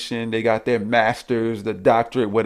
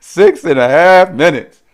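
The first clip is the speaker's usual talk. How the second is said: laughing, louder and higher; laughing disbelief; 5 semitones higher, 9 dB louder, slower than usual. Real, not acted. Laughs at video length.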